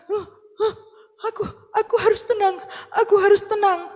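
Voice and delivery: put-on high, breathy child catching breath between sobs; calm yourself down mid-cry; sobbing child calming. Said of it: "dia diam". (anak menenangkan diri sambil terisak)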